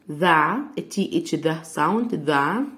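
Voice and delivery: ordinary delivery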